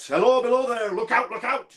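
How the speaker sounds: high pitched calling warning; ghostly call. Retold or imitated. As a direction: cry it out like a distant phantom's warning. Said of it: "It calls out".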